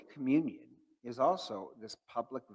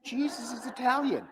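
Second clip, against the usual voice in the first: high, loud punchline delivery; pitched-up comic punchline; joke punchline; 12 semitones higher, 6 dB louder than usual. Acted. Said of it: "And so my joke is".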